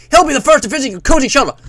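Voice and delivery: loud, high, fast American announcer; hammed-up Cold War American voice; American accent. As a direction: rattle it off like American propaganda. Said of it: "like, an American voice".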